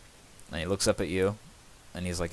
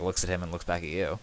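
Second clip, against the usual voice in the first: usual speaking voice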